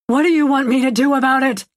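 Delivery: frustrated shout; yelling back. A narrator's reading. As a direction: yell it back at her. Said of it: "he yells back".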